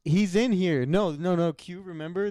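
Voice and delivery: loud excited insisting. Real, not acted.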